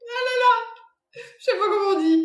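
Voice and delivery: high-pitched giggle through the words; can't stop laughing while trying to speak; giggling. Real, not acted. Medium cracking up while trying to read the name, giggling. Giggling fit during live reading.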